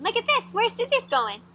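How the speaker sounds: plain reading voice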